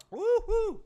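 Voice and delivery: loud high woo-hoo; whooping cheer. Real, not acted. Streamer whoops loudly after taking a drink.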